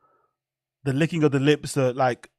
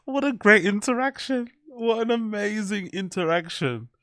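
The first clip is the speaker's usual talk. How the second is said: ordinary talk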